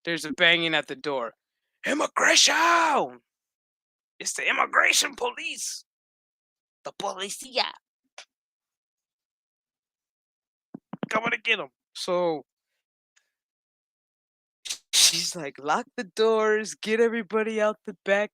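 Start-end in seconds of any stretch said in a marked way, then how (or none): 1.8-11.7 police raid shout. Acted. Cop announcing a raid at the clinic door, shouting. 15.6-18.3 urgent order. Retold or imitated. She gives orders during the raid.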